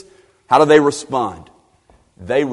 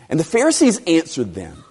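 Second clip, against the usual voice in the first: ordinary talk, nothing marked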